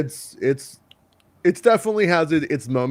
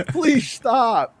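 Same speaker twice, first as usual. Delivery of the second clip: pleading; 9 semitones higher than usual. Acted. Parent begs role player to stop.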